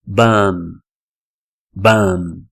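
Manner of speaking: "bum" said as bomb with no final b; correct English "bomb". Shown as a demonstration. Teacher modelling "bomb" with a silent b. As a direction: drop the final b. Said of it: "o B não tem som".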